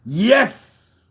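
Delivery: usual speaking voice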